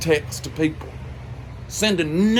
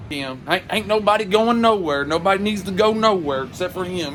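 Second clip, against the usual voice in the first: usual speaking voice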